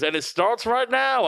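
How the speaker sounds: high, drawn-out mock launch cry; slow, soaring hype; showy announcement. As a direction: imitate a presenter kicking off a stunt. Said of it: "And he goes".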